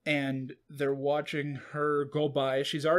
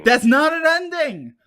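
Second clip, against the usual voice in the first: high, loud exclamation of disbelief; indignant outburst; 13 semitones higher, 11 dB louder than usual. Real, not acted. Host protesting that the finale had no ending, loud indignant cry. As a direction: blurt it out, outraged and amused.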